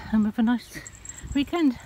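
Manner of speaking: normal talking voice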